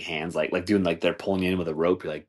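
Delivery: ordinary talk, nothing marked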